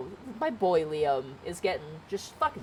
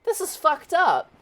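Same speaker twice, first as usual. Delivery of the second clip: high, loud, indignant cry; outraged heroine; 7 semitones higher, 4 dB louder, slower than usual. Retold or imitated. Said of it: "main character is just like".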